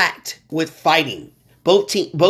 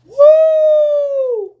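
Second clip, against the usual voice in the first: sudden high whooping shout, much louder; excited woo; 6 dB louder than usual. Real, not acted. Host whooping to the audience after his Nats talk, loud excited shout. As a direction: let out a big hype whoop.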